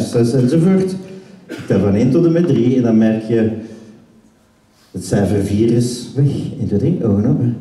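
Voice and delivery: commanding hypnotist voice; deep hypnotic command. Acted. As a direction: plant the suggestion with authority. Hypnotic suggestion to forget four.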